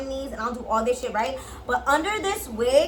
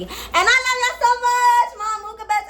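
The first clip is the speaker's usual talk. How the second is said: gushing farewell; 11 semitones higher, 5 dB louder than usual. Real, not acted.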